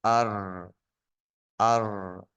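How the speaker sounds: أر as one syllable with a long, rolled r; blended syllable. Shown as a demonstration. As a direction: join vowel and r in أر, holding the trill. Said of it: "تا واش قوت شوج".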